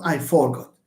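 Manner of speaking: "forgot" stressed on FOR; the mistaken FOR-got for "forgot"; stress on first syllable. Shown as a demonstration. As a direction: punch FOR and let the end of "forgot" drop.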